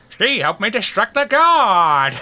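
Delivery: high, loud, slow mocking Groucho voice; Groucho Marx impression. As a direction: ham up a Groucho Marx drawl. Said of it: "Why do you sound like Groucho Marx now?".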